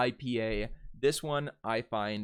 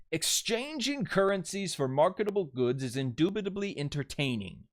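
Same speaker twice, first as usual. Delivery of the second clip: reading the challenge; 7 semitones higher, 3 dB louder than usual. Shown as a demonstration. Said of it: "I'm presenting you a sentence challenge".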